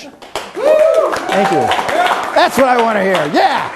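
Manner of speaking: playful high-pitched showman's thanks; falsetto mock thanks. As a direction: jump up into falsetto to milk the applause. Mock cheering himself after applause.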